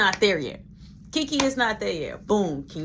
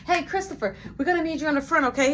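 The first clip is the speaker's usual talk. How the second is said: supervisor calling worker; 8 semitones higher, faster than usual. Retold or imitated. Boss summons worker, acted.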